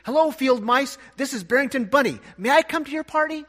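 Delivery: high, loud bunny cry through tears; tearful blurt. Acted. Bunny calls to the field mice under the ground, loud high tearful cry. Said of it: "blurted out through his tears".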